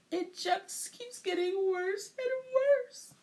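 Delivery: high, slow, tearful wail; barely holding back the sobs; near sobbing. A narrator's reading. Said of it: "She began to sob".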